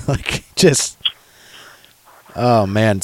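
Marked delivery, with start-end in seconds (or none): none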